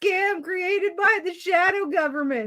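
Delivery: high, slow mock character voice; slow, high-pitched imitation; put-on show voice. Mimics Egg Boys after mention.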